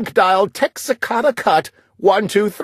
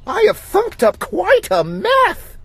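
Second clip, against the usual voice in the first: sister's dismay. A narrator's reading. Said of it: "gasped my sister".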